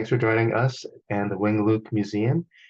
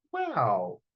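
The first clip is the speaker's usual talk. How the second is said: awed wow. Acted. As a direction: marvel at the palace. Family sees the palace.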